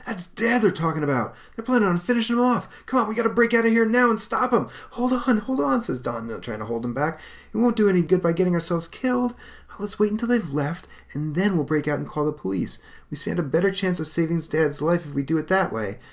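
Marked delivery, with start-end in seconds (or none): 0.1-4.7 much higher, fast, loud outburst; frantic anger. Acted. Hank learning they plan to kill Dad, frantic and furious. Hank loses his mind. 4.9-5.8 put-on much higher, pleading young voice; urgent restraint. Don restraining angry Hank, urgent pleading. Don holding him back. 7.5-16.0 much higher, earnest reasoning voice; young hero. Acted. Don convincing Hank to wait, earnest young voice.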